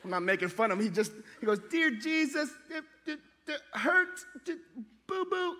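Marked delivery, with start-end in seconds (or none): 1.7-5.6 lightly voiced small boy praying, higher; halting little-kid prayer; a hint of a small child praying; child's prayer. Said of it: "my son can't talk well".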